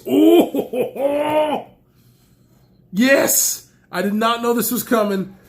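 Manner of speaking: high, loud and laughing; thrilled surprise. Real, not acted. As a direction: burst out laughing with joy at the surprise. Surprise package he loves.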